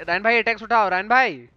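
loud urgent call to a teammate. Real, not acted. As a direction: shout his name across the map. Calling teammate in game. (ज़ोर से पुकारना)

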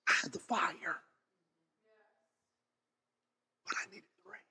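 breathy hushed whisper. Acted. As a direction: drop to a whisper to land the point. Hushed climax of testimony.

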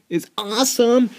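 ordinary talk

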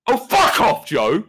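high, loud mock rage; angry British yelling. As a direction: yell in British fury on request. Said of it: "yell at me".

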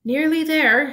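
high, loud, out of breath; panting. A narrator's reading. Harry panting to Hermione while heaving the crate. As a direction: gasp it out while hauling the crate. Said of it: "Harry panted".

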